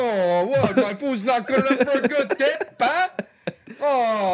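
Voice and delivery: high, loud, outraged Italian cook; angry Italian chef. Acted. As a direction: blow up like an insulted chef. Angry Italian chef over tip.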